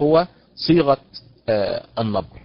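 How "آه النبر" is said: ordinary talk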